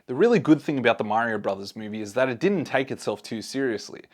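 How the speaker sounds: normal talking voice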